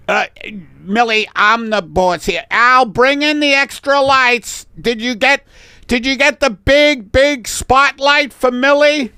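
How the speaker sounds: high, loud ordering voice; bossy photographer. Acted. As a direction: call out orders to the lighting crew. Photographer gives orders in skit.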